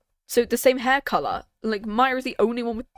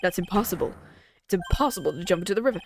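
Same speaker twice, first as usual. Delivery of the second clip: usual speaking voice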